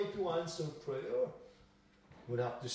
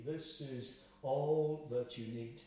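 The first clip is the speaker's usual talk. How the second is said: usual speaking voice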